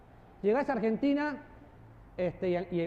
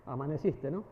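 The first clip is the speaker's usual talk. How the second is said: lower, soft amaneciste with a laugh; 7 semitones lower, 5 dB quieter than usual. Shown as a demonstration. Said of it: "¿Cómo cambia?".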